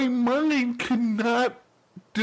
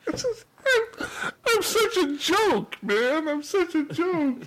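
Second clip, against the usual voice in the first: high, laughing self-mockery; laughing at himself; 9 semitones higher, slower than usual. Real, not acted. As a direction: crack up at your own failure.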